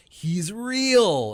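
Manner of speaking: high, loud cry of overjoyed belief; retold happy scream. Kid opening a Nintendo sixty-four, retold happy scream. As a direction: shout it out, overcome with happiness. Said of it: "I just screamed out".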